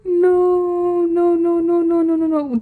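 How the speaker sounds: high, drawn-out mock wail of no; mock despair. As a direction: wail it in comic dismay. Mock despair at her own pronunciation.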